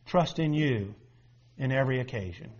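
soft, low prayer voice; quiet prayer. Real, not acted. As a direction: pray it gently, hushed. Opening prayer.

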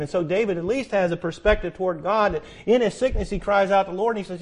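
ordinary delivery

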